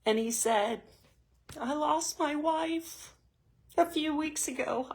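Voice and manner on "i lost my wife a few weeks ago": holding back real tears while retelling his words; voice breaking. Real, not acted. Telling women about the widower, close to tears. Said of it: "I'm gonna cry".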